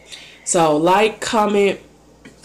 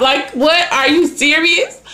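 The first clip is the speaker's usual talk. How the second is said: surprised disbelief; 7 semitones higher, slower than usual. Retold or imitated. Retelling her disbelief at being hired.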